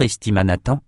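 ordinary delivery

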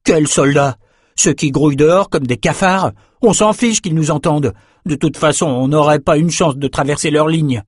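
put-on defiant, agitated young noble; not lowering his voice. A narrator's reading. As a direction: argue back, refusing to hush. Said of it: "ne baissa pas le ton".

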